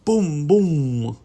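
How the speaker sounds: loud, booming drum hits; resounding drumbeat voice; drum imitation. A narrator's reading. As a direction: boom it out like a big drum. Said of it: "Bean plays his drum".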